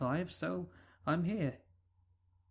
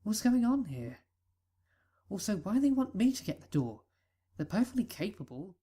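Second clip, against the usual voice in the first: plain reading voice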